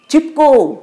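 loud, high rallying cry; ringing shout to the women and children. Acted. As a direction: call everyone to action. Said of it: "ती ओरडली".

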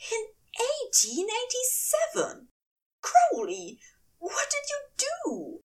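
high angel voice, horrified question. Acted. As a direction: demand it, shocked and high. Aziraphale horrified.

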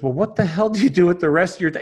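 baffled businessman demanding an explanation; can't compute it. Acted. Businessman questions the seemingly lazy fisherman, incredulous. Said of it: "he can't even compute this".

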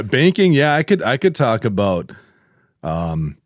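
ordinary talk

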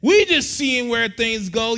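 loud, slow mocking excuse; dismissive couple. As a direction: stretch out the lame excuse. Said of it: "So what happens is this".

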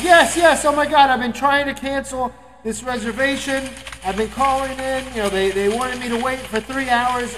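high, loud retold pleading; worked-up caller. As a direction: urgently spill the complaint into the phone. Exasperated caller finally gets through.

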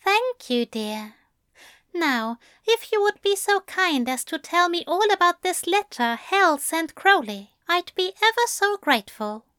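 butter him up, politely insistent; lightly voiced sweet angel. A narrator's reading.

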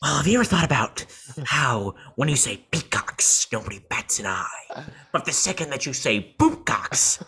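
hammed-up villain sinking into a whisper at each punchline; Joker voice. Podcaster as Joker lecturing Batman on peacocks, hammed-up Joker. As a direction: swing from full voice to hushed whisper. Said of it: "he's like".

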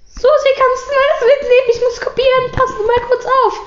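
imitating a teacher asking a pupil for a favour; mock teacher. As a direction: ham up a bossy teacher handing off a chore. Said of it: "die andere B E-Lehrerin immer so".

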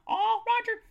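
high, loud playful squeal; gushing high-pitched cheer; squealed catchphrase. Pretend. Demonstrates the hashtag catchphrase.

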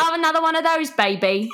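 high put-on British accent; hammed-up British accent, ordering a drink; drunken British accent. Shown as a demonstration. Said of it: "That was it".

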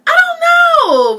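very high, loud, drawn-out wail; exclaimed bewilderment. Real, not acted. Fan asked why [name] is missing, loud wail. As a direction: cry it out slowly, pitch shooting up.

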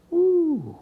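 high, soft "woo". Real, not acted. Preacher pausing after scripture, soft high woo. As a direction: let out a quiet high whoop. Exclaims between scripture and explanation.

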